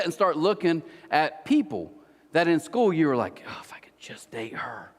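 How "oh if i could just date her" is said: breathy whispered crush confession; imitated hushed swooning. Mimicking a schoolboy admiring his crush, hushed whisper. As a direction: whisper it as if secretly smitten.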